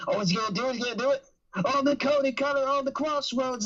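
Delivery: high, rising fretting of a crowd; mock fan panic. Wrestling fans watching Cody go for his finisher, acted panic. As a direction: act out worried fans, pitch climbing and stretching at the end. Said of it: "fans panicking and be like".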